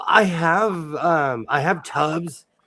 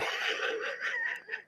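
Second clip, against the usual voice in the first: high squeaky laugh; 13 dB quieter than usual. Real, not acted. Laugh after get off my lawn.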